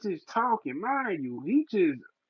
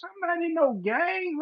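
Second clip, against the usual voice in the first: "I'm not in no gang" pitched far higher, with "no" stressed; exasperated inner voice; 11 semitones higher than usual. Retold or imitated. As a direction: throw up your hands at "no".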